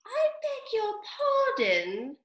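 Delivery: high, slow, frosty politeness; frowning but polite mouse. A narrator's reading. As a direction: frown while staying polite. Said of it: "frowning, but very politely".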